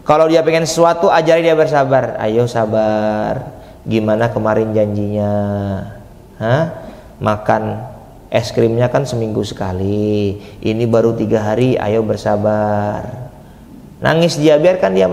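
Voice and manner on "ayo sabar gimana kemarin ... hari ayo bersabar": put-on low, unhurried coaxing of a child; soothing a child who wants ice cream; patient parent coaxing. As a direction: calmly talk a whining child into waiting.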